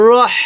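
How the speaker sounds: "রহ" com o হ final solto num sopro longo; H soprado. Shown as a demonstration. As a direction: deixe o ar fino sair do meio da garganta no fim de "রহ". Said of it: "এখানে হামস বের করতে হবে".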